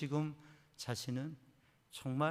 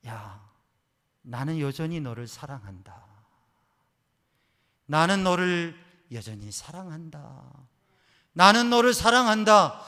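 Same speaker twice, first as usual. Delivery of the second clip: God's voice; 15 dB louder than usual. Retold or imitated. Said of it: "하나님의 음성이 들리더래요".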